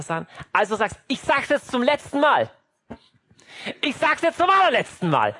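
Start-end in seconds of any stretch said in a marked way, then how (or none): 1.1-2.5 higher-pitched acted warning; exasperated mother. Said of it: "bevor Mama schreit und sagt". 3.8-5.3 high-pitched, escalated acted warning; shouting mother. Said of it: "bevor Mama schreit und sagt".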